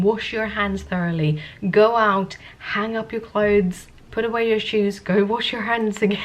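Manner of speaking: usual speaking voice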